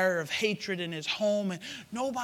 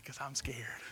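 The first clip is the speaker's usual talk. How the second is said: whispering; 6 semitones lower, 9 dB quieter than usual. Real, not acted. Whispered aside to the pastor.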